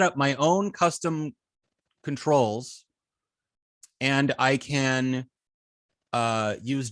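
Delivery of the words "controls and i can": usual speaking voice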